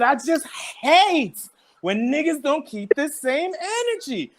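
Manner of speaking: high, slow, stretched-out declaration; from the diaphragm. Retold or imitated. August airing his grievance to Tory, imitated high and drawn-out. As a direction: imitate him projecting from the diaphragm, dragging out each word. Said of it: "he brought it from the diaphragm".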